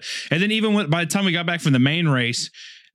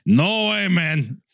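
higher, hammed-up character voice; texting voice; 3 semitones higher, slower than usual. Co-host plays his texting self judging follower numbers, mock texting voice. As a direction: stretch it out as a put-on texting persona. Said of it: "Texting voice".

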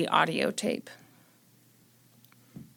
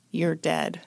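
calm satisfied; 3 semitones lower, 3 dB quieter than usual. Retold or imitated. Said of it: "in a calm, satisfied voice".

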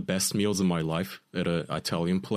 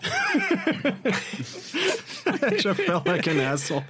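high squealing laugh through words; slower than usual. Real, not acted. Laughing at own blunder.

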